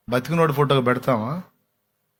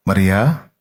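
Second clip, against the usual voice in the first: low call, lifting at the end; name her in a deep voice; 7 semitones lower, 4 dB louder, much slower than usual. Retold or imitated. Jesus calls Mary who knows His voice, low firm call.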